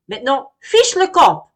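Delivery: very loud, high shout to get lost; furious bellow; angry bear shout. Acted. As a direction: chase the bee off. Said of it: "Monsieur Ours s'énerve".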